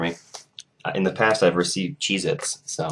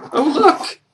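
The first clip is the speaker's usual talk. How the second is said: very high squeaky exclamation; mock-excited surprise; 4 dB louder than usual. Real, not acted. Vlogger finding another pedometer, high excited squeal. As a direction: squeal at the discovery.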